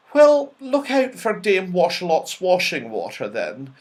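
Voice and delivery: a gentle warning in a fairy's lilt; light fairy voice. A narrator's reading.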